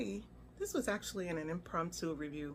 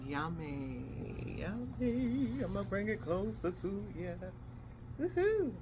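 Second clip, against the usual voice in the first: gleeful, lilting show-off; playful singsong; slower than usual. Real, not acted. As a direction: draw out the yummies in a playful lilt. Playful showing of the plate.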